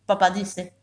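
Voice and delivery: plain reading voice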